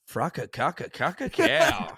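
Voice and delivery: rising comic animal call; mock fox call. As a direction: deliver the fox noise as a pick-up line. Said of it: "look her up and down and be like".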